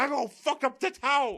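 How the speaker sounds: high, strained monster threat; hammed-up rampage vow, way up high; Hulk-style mock yell. Hulk vowing to wreck the town, high mock yell. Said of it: "And he's like".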